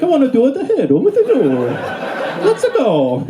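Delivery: high, cartoonish Mario-like voice; squeaky cartoon plumber; high video game hero voice; Mario character. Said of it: "I also sound like a Mario character".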